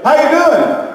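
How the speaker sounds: high, sing-out mock greeting; imitated chirpy visitors. Visitors popping into birthing room, high mock-cheery greeting. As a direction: call it out high, oblivious to the pain.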